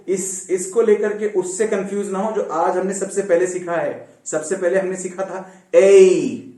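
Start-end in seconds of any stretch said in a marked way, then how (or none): none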